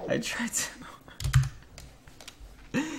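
high, unvoiced "I tried so"; breathy repeat. Real, not acted. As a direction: breathe it out half-laughing. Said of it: "I tried so hard to throw this".

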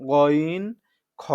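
গইন and খ said heavy and thick; full, heavy খ from the throat's end; thick ghain. Shown as a demonstration. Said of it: "এটা হচ্ছে মোটা করে". (মোটা করে গইন)

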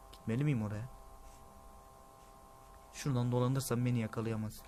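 normal talking voice

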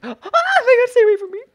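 very high, loud panicked shriek; sudden high-pitched alarm; startled scream. Real, not acted. Startled by opponent appearing.

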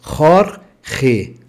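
Jorge with throaty J, horhe; Spanish Jorge. Shown as a demonstration. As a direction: rasp the J in Jorge. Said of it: "ele agora é Jorge".

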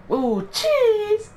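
very high falsetto squeal; excited squeal. Pretend. Gamer cheering at his own play, excited squeal.

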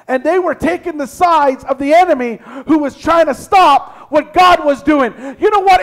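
shouting, high and loud, Jamaican-free pulpit fire; preaching at full force. Acted. Pastor rebuking false believers to his congregation, shouting sermon.

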